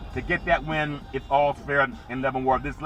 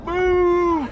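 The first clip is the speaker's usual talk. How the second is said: mock boo; 12 semitones higher than usual. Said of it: "You can boo me".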